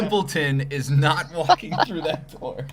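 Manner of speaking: high, booming proclamation; loud mock announcement. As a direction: shout it like a stadium announcer. Running gag, mock announcement.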